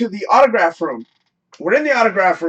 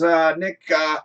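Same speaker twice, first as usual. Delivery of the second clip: usual speaking voice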